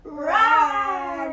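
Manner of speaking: loud, high playful rawr; loud playful tiger roar for the kids. Shown as a demonstration. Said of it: "Can you roar a little louder?".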